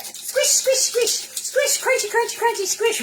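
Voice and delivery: very high, loud squeaky squishing; playful sound effects. Pretend. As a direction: chant each crunch like a cartoon noise. Voices the garlic being rolled.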